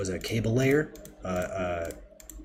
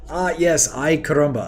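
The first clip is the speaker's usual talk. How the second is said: joking exclamation; 8 semitones higher, 7 dB louder than usual. Acted.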